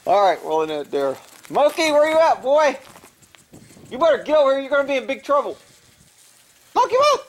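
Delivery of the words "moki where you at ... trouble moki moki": high, loud call across the yard. Real, not acted. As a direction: yell for the cat to come back. Calling the cat from afar.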